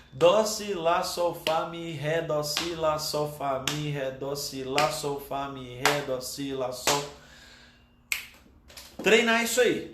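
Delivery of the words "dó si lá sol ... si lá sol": descending-order recitation. Shown as a demonstration. Teacher demonstrating the descending note order to students, even rhythmic chant. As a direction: recite the notes evenly in one continuous run. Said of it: "fazer também na ordem descendente".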